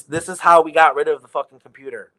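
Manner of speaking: ordinary talk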